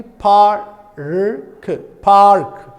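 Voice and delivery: "park" with the R clearly sounded before the k; rhotic. Shown as a demonstration. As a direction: draw out the R in "park", loud and deliberate. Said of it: "In rhotic, it will be".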